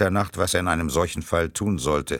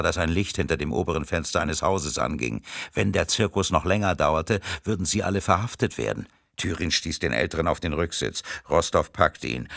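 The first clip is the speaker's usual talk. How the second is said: plain reading voice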